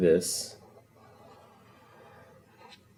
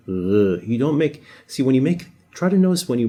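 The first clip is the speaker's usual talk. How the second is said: normal talking voice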